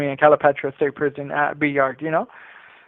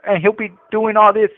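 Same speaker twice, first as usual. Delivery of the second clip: ordinary talk, nothing marked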